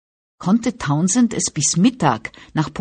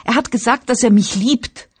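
stammering, tearful; 7 semitones higher, 4 dB louder than usual. A narrator's reading. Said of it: "stammelte sie weinend".

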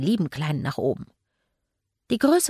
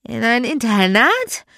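indignant growl; 5 semitones higher, 9 dB louder than usual. A narrator's reading. Magda to Jeremy about boarding school, angrily indignant. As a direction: growl back, outraged and tipsy. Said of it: "knurrte Magda aufgebracht".

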